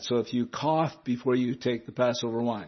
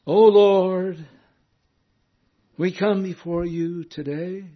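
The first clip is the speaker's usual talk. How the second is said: high, loud churchy prayer opening, mocking; mock pious prayer voice; 5 semitones higher, 4 dB louder than usual. Preacher mocking how men open the closing prayer, hammed-up pious voice. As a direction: ham up a holy-sounding preacher. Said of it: "They raise their voice at the end".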